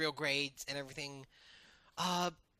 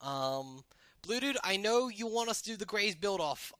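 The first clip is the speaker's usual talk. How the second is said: ordinary delivery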